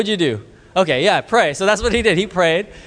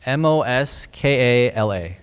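slow spelling at full voice; repeated spelling; 4 semitones lower, slower than usual. Real, not acted. Repeating the name for a listener who missed it, slowly. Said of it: "Say that again".

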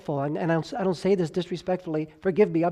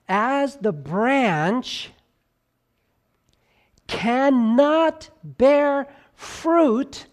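very slow, rising high and louder on "cannot bear fruit"; drawn-out emphasis; 7 semitones higher, 4 dB louder, much slower than usual. Shown as a demonstration. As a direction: stretch every word, lift "cannot bear fruit" up high. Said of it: "I'm gonna use some voice fluctuation".

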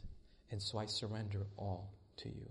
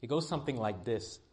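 ordinary talk

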